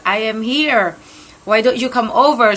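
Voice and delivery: ordinary delivery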